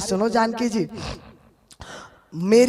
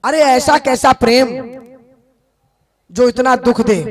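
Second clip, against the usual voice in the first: Uddhav calling; 2 semitones higher, 4 dB louder than usual. Acted. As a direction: call out to the grieving gopis. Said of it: "Uddhav ने कहा कि".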